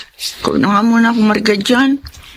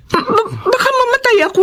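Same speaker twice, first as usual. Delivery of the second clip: stammering, high, tearful fear; frightened crying; 9 semitones higher than usual. Acted. Pregnant woman fearing death at the hilot, crying. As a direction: choke it out as if she might die. Terrified before the abortion.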